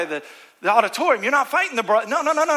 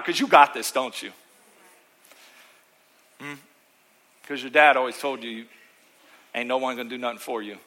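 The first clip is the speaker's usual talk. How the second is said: sarcastic; 6 semitones lower than usual. Acted. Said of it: "Now I'm done being sarcastic".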